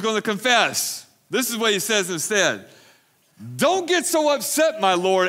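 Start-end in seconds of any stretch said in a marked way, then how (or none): 3.6-5.3 Aaron pleading, pitched up; placating whine, raised; Aaron's excuse, high pitched. A narrator's reading. Aaron voiced, read scripture.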